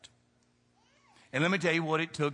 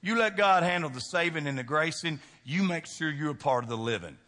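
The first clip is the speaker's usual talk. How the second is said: usual speaking voice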